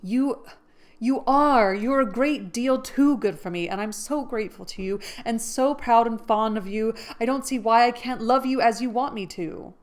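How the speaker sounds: heartfelt refusal of a proposal; Jo pleading. Acted. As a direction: plead your case gently but urgently. Jo's speech to Laurie.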